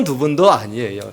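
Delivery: normal talking voice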